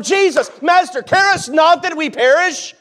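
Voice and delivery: high-pitched shouted plea; intense desperate plea. Acted. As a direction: burst in and cry out urgently for help. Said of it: "probably some intensity there".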